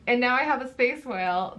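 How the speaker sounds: ordinary talk, nothing marked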